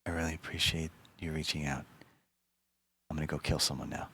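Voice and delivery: soft, low, murderous politeness; menacing whisper. Retold or imitated. Whispering fake thanks after hearing the guy is fine, then a calm threat. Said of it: "It's like".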